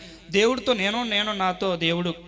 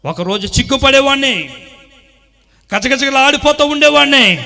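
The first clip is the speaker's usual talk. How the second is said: loud, higher preacher's cry; preaching at full force; 8 semitones higher, 13 dB louder than usual. Acted. Preacher thundering to the congregation, full force. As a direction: drive each line home to the church.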